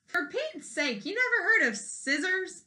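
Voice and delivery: a mild exasperated outburst at the queen; exasperated cry. Acted. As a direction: Paulina cries out at the queen in mild disbelief. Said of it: "Paulina cried".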